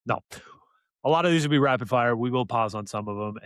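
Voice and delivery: ordinary talk, nothing marked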